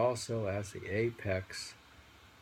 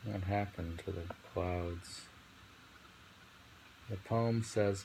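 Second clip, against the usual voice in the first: normal talking voice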